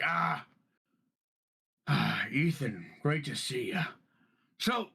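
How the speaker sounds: lightly voiced boisterous captain; warm, drawn-out hello to an old friend; hearty captain greeting. Captain North greets Ethan, hearty and lightly voiced. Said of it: "and he's like".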